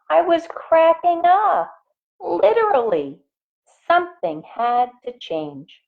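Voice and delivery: lively picture-book reading; animated storybook read; reading to children. A narrator's reading. Reads picture book aloud.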